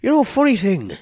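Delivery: doddering grandad voice; Storytime Grandpa voice. Acted. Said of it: "so Storytime Grandad, how are you?".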